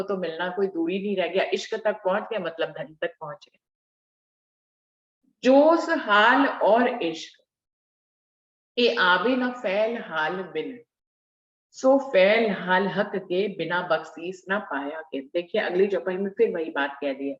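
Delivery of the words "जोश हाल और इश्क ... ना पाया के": slow, measured scripture reading; verse recitation. Real, not acted. Preacher reciting scripture to the congregation, slow verse recitation. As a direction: draw out each word of the verse.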